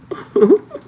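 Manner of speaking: sharp, loud, high burst of laughter; real laugh rising high; loud high laugh at the friend's joke. Real, not acted.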